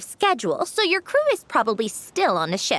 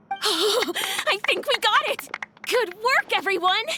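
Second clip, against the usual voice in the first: high delighted wrap call; excited call of cut; 11 semitones higher, slower than usual. Real, not acted. Director calling cut to the crew, thrilled the scene is done. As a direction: cheer the crew as the take lands.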